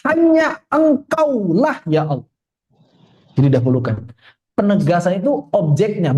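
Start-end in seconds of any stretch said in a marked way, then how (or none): 0.1-1.8 "hanya engkaulah" high and drawn out; emphatic. Shown as a demonstration. Ustadz explaining the verse's emphasis, slow emphatic "hanya engkaulah". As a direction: stretch each word of "hanya engkaulah" for emphasis. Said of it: "Faidahnya ingin menegaskan bahwa".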